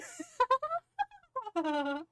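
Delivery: loud high victory laughter; triumphant laugh. Real, not acted. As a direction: cackle with delight.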